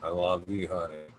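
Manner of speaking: greeting his daughter in the chat, tender and soft. Real, not acted.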